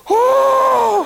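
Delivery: very high, loud shriek of shock; shocked cry. Retold or imitated. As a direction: gasp out loud in horror. Roommate shocked at rock music.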